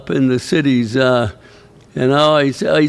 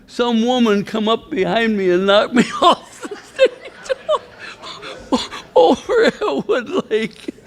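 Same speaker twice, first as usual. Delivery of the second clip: high, breaking into real laughter; can barely finish for laughing; 7 semitones higher than usual. Real, not acted. Laughing while telling the mishap.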